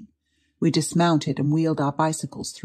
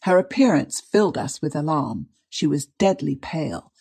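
ordinary delivery, nothing marked

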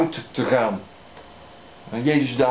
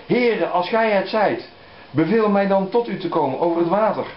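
Peter pleading; 6 semitones higher, 3 dB louder than usual. Acted. Peter calls to Jesus.

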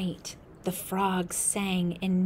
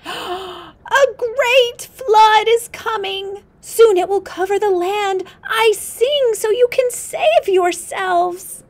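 high, loud, slow crying out; frog's cry; 13 dB louder, slower than usual. Acted. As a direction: shout the warning to the whole village. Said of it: "the frog sang out".

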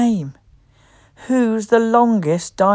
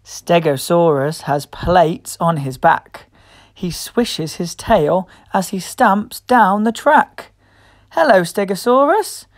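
plain reading voice

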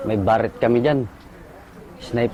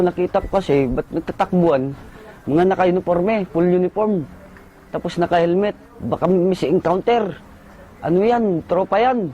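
higher, urgent, hurried report; urgent battlefield report; 7 semitones higher than usual. Retold or imitated. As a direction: tell it like bullets are flying. Nag-uulat sa gitna ng putukan. (nagmamadaling ulat sa gitna ng putukan)

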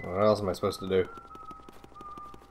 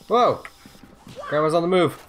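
surprised yell; 7 semitones higher, 5 dB louder than usual. Real, not acted. Surprised at grandma ghost.

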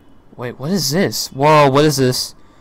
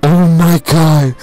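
gasping between drawn-out exclamations; real overwhelmed excitement, gulping air between phrases; breathless awe; 3 dB louder, slower than usual. Real, not acted.

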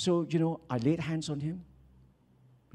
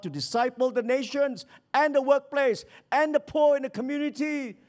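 loud, high, rousing pulpit delivery; full-force preaching; 10 semitones higher, 6 dB louder than usual. Acted. Preacher rallying the church to act, full-force preaching. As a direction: proclaim it to stir the congregation.

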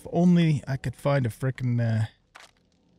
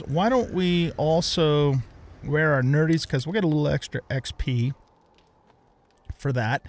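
ordinary talk

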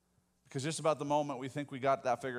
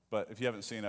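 ordinary talk, nothing marked

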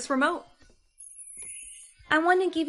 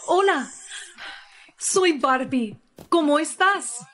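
louder, slow doll voice in Spanish; Spanish-mode doll; 4 dB louder than usual. Acted. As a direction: speak like a reset toy. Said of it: "she reset you onto Spanish mode".

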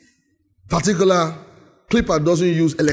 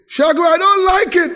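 higher, louder put-on voice; a booming complaint from above; mock voice of God; 12 semitones higher, 5 dB louder than usual. Preacher voicing God's big complaint.

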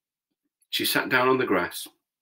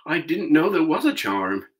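surprised; 8 semitones higher, 4 dB louder than usual. A narrator's reading. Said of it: "answered Dorothy in surprise".

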